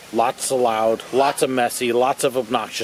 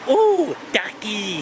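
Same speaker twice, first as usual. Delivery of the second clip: imitating a cooing man; 7 semitones higher, much slower than usual. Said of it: "this guy going".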